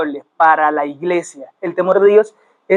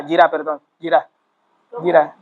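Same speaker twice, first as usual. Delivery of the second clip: ordinary talk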